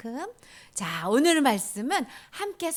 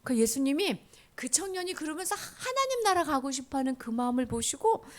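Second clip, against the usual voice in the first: ordinary talk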